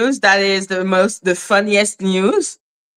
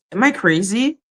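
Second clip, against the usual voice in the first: normal talking voice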